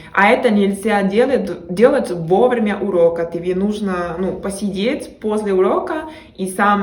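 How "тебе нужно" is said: normal talking voice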